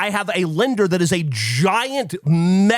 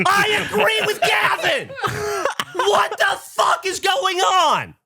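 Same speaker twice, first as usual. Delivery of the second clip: high, laughing shout, slow on the last line; laugh, then shout the question high; laughing outrage; slower than usual. Real, not acted. Agreeing with Gavin in a high laughing shout.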